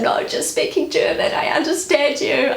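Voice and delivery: pleading with her to stay in German; a hint of an exhausted mother in labour; high reassuring plea. Reassuring the midwife during labour.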